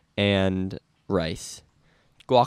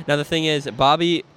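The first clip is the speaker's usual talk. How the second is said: ordinary delivery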